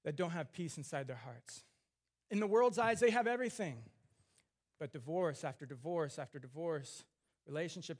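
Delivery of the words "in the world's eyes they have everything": louder and higher, hammering the point home; preaching emphasis. Real, not acted. Preacher to congregation, loud ringing emphasis. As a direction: lift the voice to drive it in.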